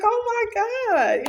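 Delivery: very high, loud "Oh my God"; excited squeal. Retold or imitated. Wife reliving the dream to husband, very high loud squeal. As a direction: squeal it out through laughter. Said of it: "I was like".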